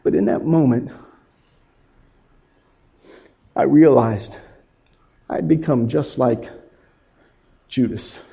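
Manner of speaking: low, hushed and halting; quiet shame, dropping away at the end; stricken realization. Acted. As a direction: let it sink in, softly, with pauses. Peter realizes his betrayal.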